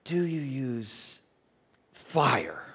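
very slow question, paused before the last word; Nick Costas. Retold or imitated. Club owner, as imitated, asks the pastor about fire, slow and deliberate. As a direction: imitate a deliberate man drawing out each word. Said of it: "and so he said".

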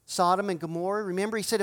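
ordinary talk

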